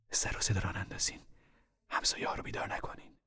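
whispered, urgent but soft; hushed warning. Acted. Mother hushing kids so neighbors sleep, whispering. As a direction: shush them so the camp stays asleep.

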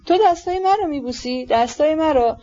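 moved disbelief. Acted. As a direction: marvel tearfully at your son's kiss. General moved by son's kiss. (با حیرت و تأثر، با صدای زیرتر)

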